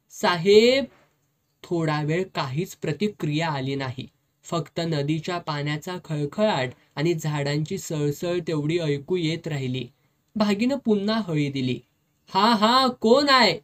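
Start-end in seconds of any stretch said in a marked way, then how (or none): 0.1-0.8 loud rising call across the distance. A narrator's reading. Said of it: "खोल आवाजात नेहमीप्रमाणे हाळी दिली". 12.3-13.6 loud, higher gruff call from inside; a drunk master calling out; heavy voice. A narrator's reading. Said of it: "साहेबाचा जड आवाज आला".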